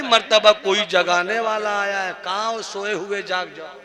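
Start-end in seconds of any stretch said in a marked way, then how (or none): 2.2-3.7 soft call. Acted. Softly calling sleepers.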